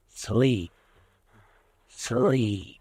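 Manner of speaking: usual speaking voice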